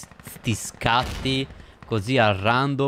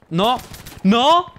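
high loud cry of dismay; desperate shout; 11 semitones higher, 7 dB louder than usual. Real, not acted. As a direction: shout no in panic. Gamer seeing death.

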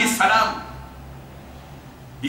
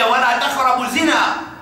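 Quran recitation; 4 semitones higher, faster than usual. Acted.

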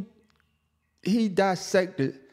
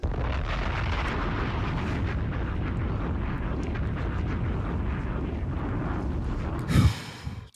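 long sigh; 6 dB quieter than usual. Real, not acted. Exasperated reaction to diss track.

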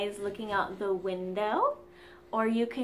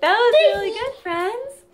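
kindergarten teacher voice; 9 semitones higher, 8 dB louder than usual. Acted. Praising children after song.

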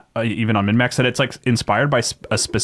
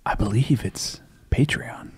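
right in your ear; 5 dB quieter, slower than usual. Pretend. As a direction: close to the mic, soft and slow. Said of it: "scoot your mouth a little closer to the mic and whisper".

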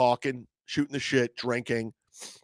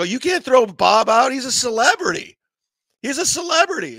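put-on drunk indignant protest; 11 semitones higher, 6 dB louder than usual. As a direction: drunkenly plead for Bob.

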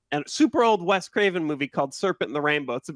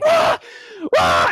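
friend screaming; 7 dB louder than usual. Retold or imitated. Friend wakes up screaming at the movie, loud shrieks. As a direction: scream like a startled sleeping friend. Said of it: "my friend's like".

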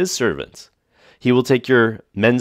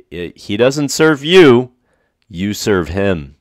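usual speaking voice

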